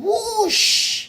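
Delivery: high, loud rushing whoosh; steam whoosh. A narrator's reading. Thomas blowing steam at the red-haired boy, loud whoosh. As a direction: blast it out like steam.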